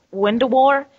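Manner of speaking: ordinary talk, nothing marked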